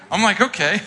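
amused, resigned okay; chuckling agreement; laughing okay. Retold or imitated.

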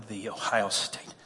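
mocking a pompous alum, quietly. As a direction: murmur it like a snob. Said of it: "How pompous".

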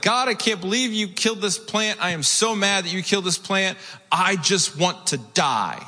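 put-on high, whiny two-year-old tantrum voice. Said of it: "like a two-year-old temper tantrum".

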